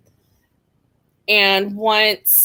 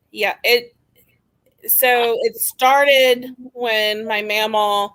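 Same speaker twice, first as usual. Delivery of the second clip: usual speaking voice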